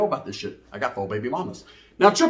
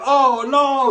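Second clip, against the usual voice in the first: mock dread; 8 semitones higher, 5 dB louder than usual. Dread at being caught.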